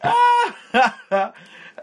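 shrieking burst of laughter; squealing giggle fit; high squealing laugh. Real, not acted. Friends laughing at recollection.

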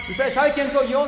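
loud, high self-important guest; retold indignant shout. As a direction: yell it as an offended VIP. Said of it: "le empezó a gritar".

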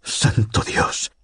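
breathless whisper; whispered oath. A narrator's reading. Godliman covers the receiver and whispers in shock. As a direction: let it slip out, hushed and slow. Pale, hand over the receiver.

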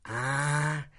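ordinary talk

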